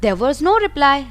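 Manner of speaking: ordinary talk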